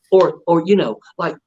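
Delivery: ordinary delivery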